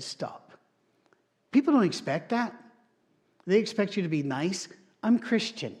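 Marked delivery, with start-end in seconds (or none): 0.0-0.6 breathy whispered stop, hand raised; firm hushed stop. Retold or imitated. Pastor cutting off gossiping golfer, whispered stop. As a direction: hush him with one word. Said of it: "I put my hand up, said".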